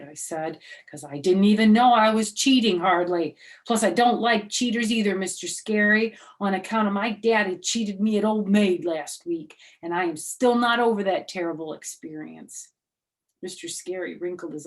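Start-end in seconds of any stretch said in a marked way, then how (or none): 0.9-12.7 put-on louder, higher kid voice; little girl complaining. Junie B telling Mister Scary about her cheating daddy, loud kid voice.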